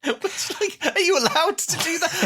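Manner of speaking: high squeaky laughing question; laughing in disbelief. Real, not acted.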